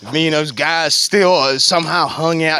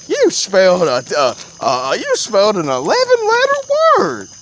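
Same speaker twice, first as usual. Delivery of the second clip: mock praising teacher; 8 semitones higher, 7 dB louder than usual. Teachers praise him, retold.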